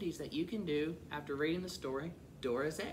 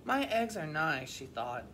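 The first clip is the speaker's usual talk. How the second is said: lively, expressive picture-book reading; animated storybook voice; children's story narration; 4 semitones higher than usual. A narrator's reading. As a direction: read to young children with clear, lilting emphasis. Reads a picture book aloud.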